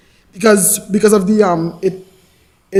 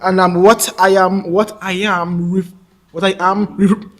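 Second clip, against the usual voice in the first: blocked partway into a word; real stammer, word never completed. Real, not acted. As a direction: restart the phrase and stick on the same syllable. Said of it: "I may stammer from time to time".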